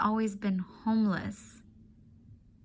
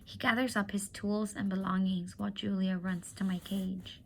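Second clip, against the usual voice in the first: usual speaking voice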